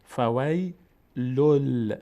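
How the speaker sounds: فویلل slowly, holding the doubled ل; merged lam. Shown as a demonstration. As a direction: chant فویلل in slow syllables, leaning on the joined lam.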